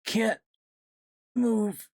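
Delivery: high, halting moan, broken by a pause. A narrator's reading. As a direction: moan it helplessly, word by word. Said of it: "Cassie moaned".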